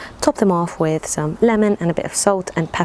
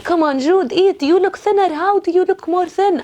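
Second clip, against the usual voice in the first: fussing aunties; 11 semitones higher than usual. Retold or imitated. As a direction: nag like a worried auntie.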